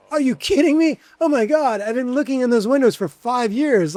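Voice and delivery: can't believe the luck, gush it out; excited disbelief. Retold or imitated. Toby to the grandson offering the keys, excited. Thrilled at being offered the space.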